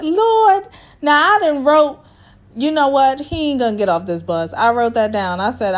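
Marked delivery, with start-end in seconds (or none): 0.0-0.7 high, louder exclaimed Lord; exasperated Lord. Retold or imitated. Recapping her own reaction to Jeff walking up, a high exclaimed Lord. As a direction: let it burst out in disbelief. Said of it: "I was like".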